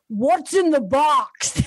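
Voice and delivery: slow, drawn-out mock voiceover line; hammy, drawn-out dramatic question; mock bad voiceover. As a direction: stretch it out like a terrible movie voiceover. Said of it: "they have the worst voiceover and she goes".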